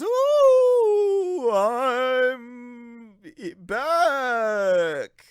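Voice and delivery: high wavering ghostly moan; spooky ghost wail. Acted. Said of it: "spooky ghost voice".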